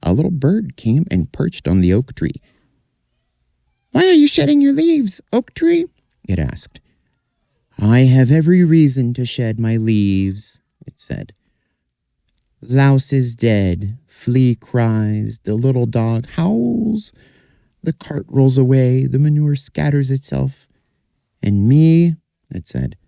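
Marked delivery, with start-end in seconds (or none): 3.9-5.9 very high, tiny bird voice; little bird voice. Acted. Little bird asking the oak tree, very high voice. 7.8-10.6 a bit higher, lightly voiced oak; gently voiced sad tree. Oak answering the little bird, lightly voiced. 12.6-22.2 a bit higher, lightly voiced oak; gently voiced sad tree. Oak answering the little bird, lightly voiced.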